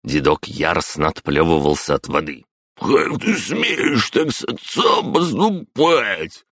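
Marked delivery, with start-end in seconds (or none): 2.8-6.4 high, slow outraged grandpa; indignant old man. Acted. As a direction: sputter in outrage. Дед возмущается под краном. (возмущённый дед)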